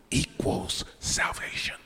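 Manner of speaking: hushed, breathy reveal after a pause; sudden pulpit whisper; dramatic whisper. Acted. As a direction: drop to a whisper to land the point. Dramatic pause then punchline.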